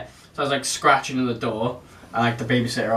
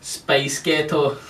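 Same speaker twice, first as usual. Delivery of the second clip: Scottish-sounding American attempt; 2 semitones higher, much slower than usual. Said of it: "you just said it in a Scottish accent".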